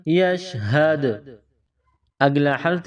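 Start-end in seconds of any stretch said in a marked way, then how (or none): 0.1-1.1 یشہد slow, final د bounced; qalqalah on dal. Shown as a demonstration. Teacher showing pupils the bounce on د. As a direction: bounce the د as you stop. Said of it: "قلقلہ کیا جائے گا".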